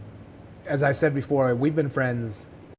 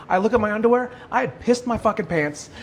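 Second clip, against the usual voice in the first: usual speaking voice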